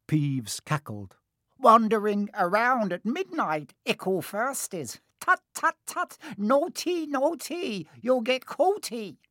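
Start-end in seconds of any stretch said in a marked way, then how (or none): 1.6-9.2 much higher sly singsong voice; high mocking tut-tut poltergeist; taunting poltergeist. A narrator's reading. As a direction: tease the kids like a gleeful spirit.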